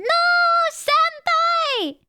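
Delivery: high dramatic wail; playful cry of despair; mock anguish. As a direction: cry out for a fallen senpai, hammed up. Mock distress at character fainting.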